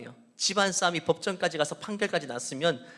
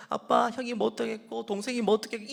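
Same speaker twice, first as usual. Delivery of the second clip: put-on whiny tattling child; 6 semitones higher, 5 dB louder than usual.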